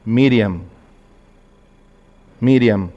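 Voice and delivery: low, measured calling of a name; Jesus calling. Acted. Jesus calls the grieving Mary by name, low measured call. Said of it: "Jesús le dijo".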